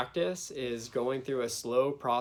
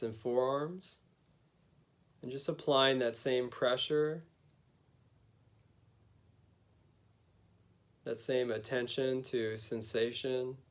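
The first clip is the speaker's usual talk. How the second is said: guided meditation. Acted. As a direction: gently lead them inward, unhurried.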